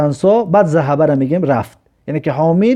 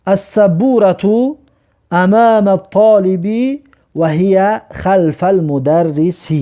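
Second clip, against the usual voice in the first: slow, careful recitation; slow model reading; 2 semitones higher, slower than usual. Shown as a demonstration. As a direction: read it out deliberately. Teacher reads Arabic example sentence.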